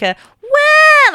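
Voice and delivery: high loud twangy wah like a cry. Shown as a demonstration. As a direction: demo a bright cry-like wah. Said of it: "like a kind of cry".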